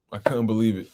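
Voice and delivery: ordinary delivery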